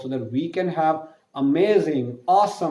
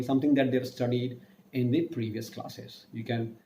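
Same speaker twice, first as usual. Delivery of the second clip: usual speaking voice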